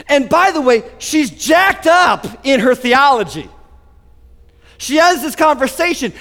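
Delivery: ordinary delivery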